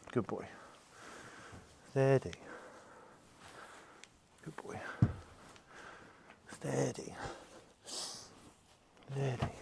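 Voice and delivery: hushed, near-whispered reassurance; soothing the horse. Real, not acted. Trainer calming a horse that kicks, hushed reassurance. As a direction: settle him down softly.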